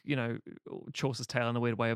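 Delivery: normal talking voice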